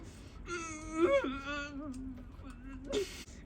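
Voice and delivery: high, strained, muffled squeal; held-in yell. Retold or imitated. Fan celebrating without scaring mom, holding in a yell. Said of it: "instead of yelling, I was going like".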